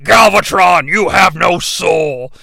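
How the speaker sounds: slow, solemn Optimus Prime voice. Retold or imitated. Prime declaring it to Galvatron, a slow solemn Optimus voice. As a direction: pronounce judgment like a noble robot leader. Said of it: "Prime's like".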